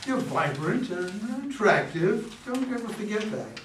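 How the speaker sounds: loving reassurance. Acted. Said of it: "Abe looks at Carol with love".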